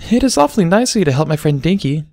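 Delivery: high, shy little voice; small bashful child voice; bashful filly. Acted. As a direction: say it shyly to him. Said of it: "she said bashfully".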